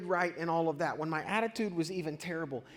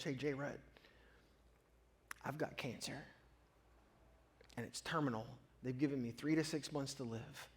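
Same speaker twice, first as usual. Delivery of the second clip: quiet grave news; 4 semitones lower, 8 dB quieter than usual. Retold or imitated. Dying friend's phone call retold.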